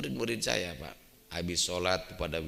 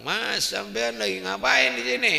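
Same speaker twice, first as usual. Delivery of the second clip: a much higher, louder devil voice; Iblis voice; 13 semitones higher, 7 dB louder than usual. Acted. Iblis asking the plotters at the door, high and loud. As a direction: play the sly devil asking.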